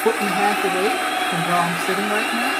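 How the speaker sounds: earbud mic, talking loud over crowd noise; bluetooth headset mic. Real, not acted. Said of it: "now the crowd noise is going".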